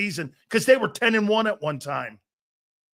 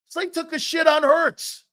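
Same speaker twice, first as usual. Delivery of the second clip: angry shout; 7 semitones higher than usual. Real, not acted. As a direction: yell it out in outrage.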